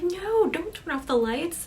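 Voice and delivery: high-pitched, urgent objection; alarmed protest. Acted. Sarah objecting to friends switching off the lights, alarmed protest.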